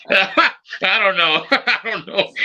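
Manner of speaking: real chuckle through the words, high and slow; laughing. Real, not acted. Laughs at guest's reply.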